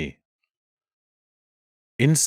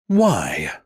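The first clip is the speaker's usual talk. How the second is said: growled; 4 dB louder than usual. Acted. Animal leader growling at the empty throne. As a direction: snarl the question at the room. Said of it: "she growled".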